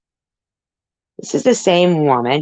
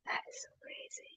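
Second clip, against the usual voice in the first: whisper; 26 dB quieter, faster than usual. Real, not acted. Aside muttered to viewers.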